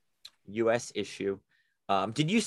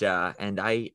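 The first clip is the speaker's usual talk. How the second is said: normal talking voice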